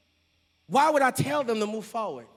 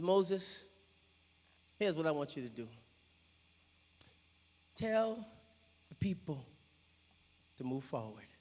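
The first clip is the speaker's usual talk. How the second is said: much lower and softer, deliberate; low calm command; 7 semitones lower, 13 dB quieter than usual. Acted. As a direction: speak it low and steady. God's calm instruction.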